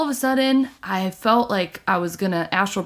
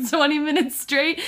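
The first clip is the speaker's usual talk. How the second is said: normal talking voice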